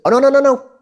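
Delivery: high, loud frantic squeak; a tiny creature terrified; panicked squirrel. Acted. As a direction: squeal in sudden alarm.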